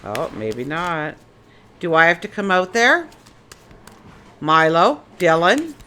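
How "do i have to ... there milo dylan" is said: loud call across the yard. Real, not acted. Owner loudly calls her dogs from outside. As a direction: call the dogs by name, louder.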